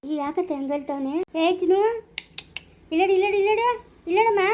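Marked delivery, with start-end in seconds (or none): 1.4-4.4 louder, higher, urgently calling out; frantic family member calling out over the baby, urgent and raised. Acted. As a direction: call it out in alarm.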